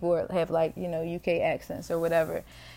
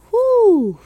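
whew; 14 dB louder than usual. Real, not acted. Reaction to anxiety thought.

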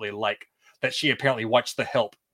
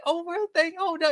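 much higher, surprised little gasp of a voice; Rebecca breaking character. Retold or imitated. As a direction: catch yourself dropping the act, pitched way up. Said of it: "she'll be like".

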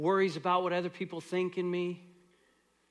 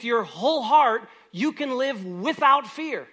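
full-force preaching; 6 semitones higher, 9 dB louder than usual. Acted. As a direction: preach it out to the congregation. Preaching builds to a climax.

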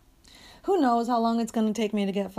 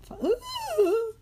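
voice cracking; 13 semitones higher than usual. Real, not acted. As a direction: voice breaks high. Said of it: "as I go through puberty".

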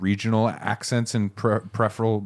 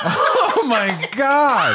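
high, loud burst of laughter; laughing shout; 11 semitones higher, 4 dB louder, much slower than usual. Real, not acted. Co-host reacting to the cancer-patient story, laughing shout. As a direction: shout it out while cracking up.

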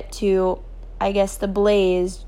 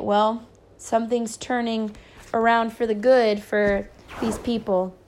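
plain reading voice

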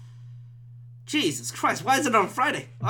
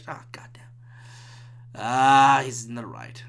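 usual speaking voice

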